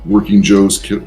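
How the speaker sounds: ordinary delivery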